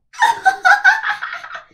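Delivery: high, loud cackling laughter; mocking laugh. A narrator's reading. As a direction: cackle scornfully at her. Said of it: "laughed the stepsisters".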